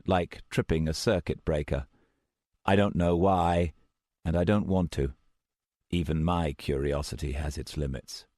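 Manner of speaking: usual speaking voice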